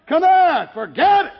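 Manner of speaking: lightly voiced preacher calling loud; high, loud preacher call. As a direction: call him over across the snow. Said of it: "the preacher came out and he said".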